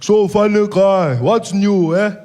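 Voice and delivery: menacing slow greeting, weighing each word; Malema voice. Retold or imitated. Malema greets the comedian at his table, slow and menacing. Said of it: "he's like".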